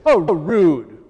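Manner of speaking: loud, hammed-up indignant retort; mock offence. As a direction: play Peter affronted at being cut off. Imagined retort from interrupted Peter.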